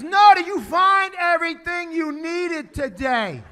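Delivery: slow, loud and high, spelling it out; exasperated slow shout through glass; slow loud repeat. Retold or imitated. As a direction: shout each word slowly so he gets it. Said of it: "she goes, yelling through glass".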